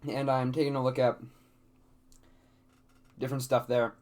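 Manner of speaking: talking through hiccups; hiccuping between words. Real, not acted. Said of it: "Sorry, I've got the hiccups".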